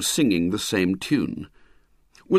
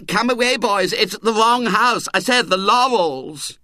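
shrill cry; 10 semitones higher, 4 dB louder than usual. Acted. Choirmaster calling boys away, shrill high pleading. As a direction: shrilly beg them to come away. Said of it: "kept up its shrill cry".

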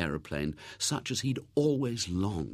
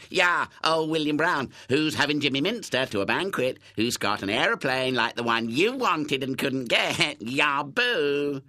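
high, loud taunting chant; jeering cry; 6 semitones higher, 4 dB louder than usual. A narrator's reading. Councillors taking up Hubert's cry against William, jeering. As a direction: jeer like gloating schoolboys. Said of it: "took up the cry".

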